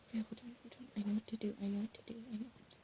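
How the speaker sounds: soft unvoiced whisper. Real, not acted. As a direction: whisper it secretly. Secretive plan.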